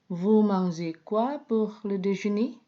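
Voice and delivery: "Vous mangez quoi pour le déjeuner?" asked with a rising tune at the end; raising intonation. Shown as a demonstration. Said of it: "dengan raising intonation". (intonasi naik: "Vous mangez quoi pour le déjeuner?")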